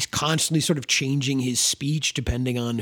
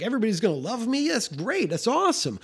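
higher, fast gushing; mock delight; 7 semitones higher, faster than usual. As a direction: play him as thrilled by praise. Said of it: "'cause he's like".

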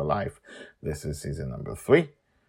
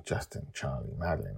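ordinary delivery, nothing marked

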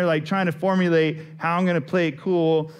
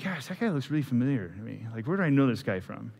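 quiet inner thought; 3 semitones lower, 6 dB quieter, faster than usual. Retold or imitated. Inner thought spotting a familiar face.

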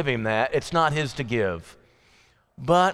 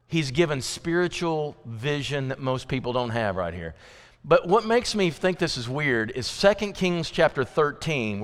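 normal talking voice

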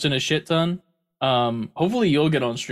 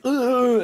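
groan; 12 semitones higher, 4 dB louder than usual. Retold or imitated. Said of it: "she does the whole thing where she's like".

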